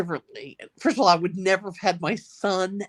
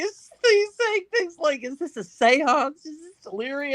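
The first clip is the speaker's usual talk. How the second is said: laughing mock quote; 8 semitones higher than usual. Mocking the questioning.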